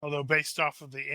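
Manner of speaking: usual speaking voice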